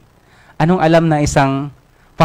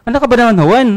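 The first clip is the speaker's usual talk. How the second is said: higher, louder nagging complaint; exasperated scolding; 8 semitones higher, 3 dB louder than usual. Acted. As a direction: scold your coworker loudly. Pedro scolds Juan over lunch. (pasigaw na pagsermon, mas mataas at malakas)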